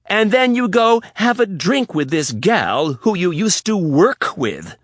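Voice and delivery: high, loud, building tirade. Acted. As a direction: let it build to a shout. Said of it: "Riley was getting louder".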